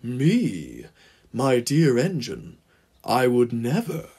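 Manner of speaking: oily, unhurried feigned innocence; sly villain playing innocent; smug mock innocence. A narrator's reading. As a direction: deny it sweetly through a big smug grin. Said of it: "the shit-eating grin spread across his callous grill".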